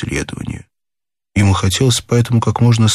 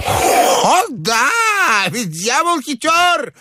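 put-on shrill, manic old man; high-pitched crazed outburst; piercing and shouty. A narrator's reading. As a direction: crow it in a very high screech. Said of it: "засмеялся как-то пронзительно и крикливо".

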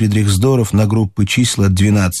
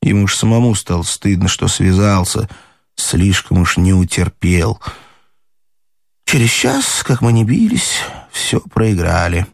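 plain reading voice

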